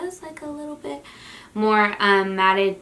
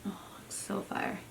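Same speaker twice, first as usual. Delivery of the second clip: whisper; 14 dB quieter than usual. Real, not acted. Awed over highlighter.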